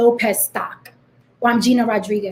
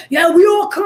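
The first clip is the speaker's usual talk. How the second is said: mock mob boast; 7 semitones higher, 5 dB louder than usual. Mocking commenters' mob boast.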